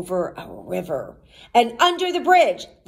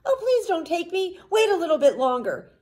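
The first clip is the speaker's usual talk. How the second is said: lightly voiced pleading goat, higher pitch; not-so-small goat voice; 9 semitones higher, faster than usual. A narrator's reading. Second goat begging the troll, not-so-small voice. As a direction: plead a bit high as the middle goat. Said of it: "his voice was not so small".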